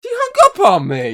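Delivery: very loud, high hammed-up complaint; mock shout. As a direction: bellow it like an outraged dad. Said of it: "M-, ma'am".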